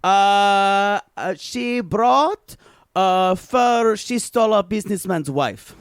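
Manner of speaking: broken-English Olga, deadpan and boastful; Olga character. Acted. A made-up Olga telling the hosts about her trip, deadpan and broken. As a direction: play Olga as a blunt foreign traveler.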